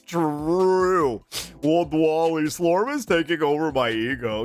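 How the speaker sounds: lightly voiced brain slug; hint of a dopey slug voice; Slorb voice. Shown as a demonstration. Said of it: "By stimming and Slorb voice".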